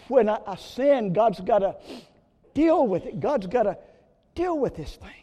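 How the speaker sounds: loud, high, ringing sermon build; preaching at full force. Acted. Preacher hammering home to the congregation, loud and high. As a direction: preach it out to the hall.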